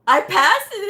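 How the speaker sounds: high, loud amazed cry; retold shout of triumph; acted elation. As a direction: burst out with disbelieving joy. Retold joy at passing exam.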